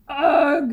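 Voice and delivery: a loud pained ugh; weak moan. A narrator's reading. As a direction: groan out in defeat. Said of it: "moaned the Tooth weakly".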